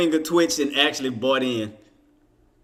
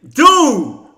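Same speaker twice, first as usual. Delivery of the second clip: loud, higher exasperated Dude; frustrated shout; 10 semitones higher, 9 dB louder than usual. Real, not acted. To the trading screen after a missed order, frustrated shout. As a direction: blurt it out at the screen.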